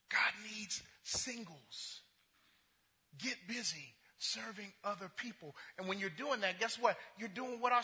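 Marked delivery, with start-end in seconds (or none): 0.1-5.6 slow, breathy stage whisper. Acted. Pastor hushing his voice to the congregation, slow whisper. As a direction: lean in and whisper it like a secret.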